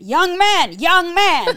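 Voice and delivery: put-on loud, high calling old man; hammed-up old man hailing. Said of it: "he went".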